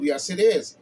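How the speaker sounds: normal talking voice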